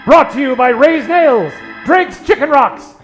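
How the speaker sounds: ordinary talk, nothing marked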